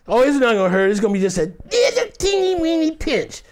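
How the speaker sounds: climbing into a tiny high squeak; mock reassurance. Crew lying to actor about squib, mock reassurance. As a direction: play it down in a mocking, high, dainty voice.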